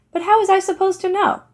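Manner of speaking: high, loud whiny protest; defensive protest. Acted. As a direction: protest your innocence, voice jumping up. Fischel protests his ignorance.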